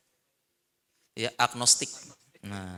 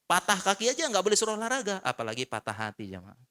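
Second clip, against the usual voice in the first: ordinary talk, nothing marked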